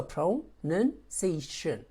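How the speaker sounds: PRONUNCIATION slowly broken into separate syllables. Shown as a demonstration. Teacher showing it has several syllables, PRONUNCIATION slowly split syllable by syllable. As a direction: say PRONUNCIATION in slow pieces, with a gap between syllables. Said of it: "is not a single syllable word".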